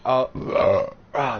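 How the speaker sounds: hiccuping mid-sentence; hiccups. Real, not acted. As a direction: caught by a hiccup. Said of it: "there it goes".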